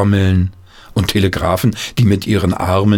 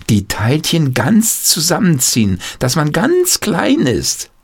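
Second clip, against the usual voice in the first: a hint of an eager small boy, higher; boy Peter, lightly voiced; 9 semitones higher than usual. Peter telling his father about walking through doors, lightly boyish. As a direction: lightly play the clever little boy explaining.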